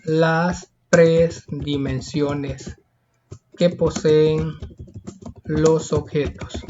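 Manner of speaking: slow, measured dictation with pauses between phrases; dictation pace. Shown as a demonstration. Teacher dictating an answer slowly.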